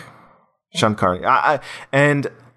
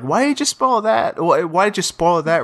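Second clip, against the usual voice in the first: mock indignation; 5 semitones higher than usual. Reader scolding the critic over a spoiler, mock indignation. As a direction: complain in a raised, incredulous pitch. Acts out complaint to the reviewer.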